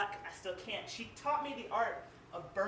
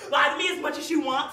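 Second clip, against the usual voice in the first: pleading shout; 6 semitones higher, 12 dB louder than usual. Acted. Poem climax, pleading outcry.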